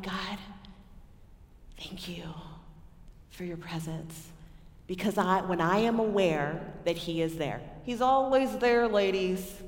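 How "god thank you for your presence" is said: much softer, a quiet grateful prayer; hushed prayer. Retold or imitated. Speaker praying alone after a friend's prayer, hushed. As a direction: whisper thanks with pauses.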